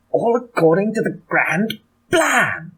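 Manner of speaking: high, drawn-out booming commander, landing hard on Plan; General Melchit. Acted. Game master quoting a favourite phrase in his head, hammy general impression. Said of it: "in General Melchit's voice".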